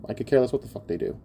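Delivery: ordinary talk, nothing marked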